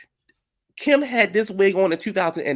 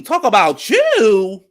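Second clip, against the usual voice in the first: incredulous retort; 6 semitones higher, 8 dB louder, much slower than usual. Real, not acted. Mocking retort to Karen.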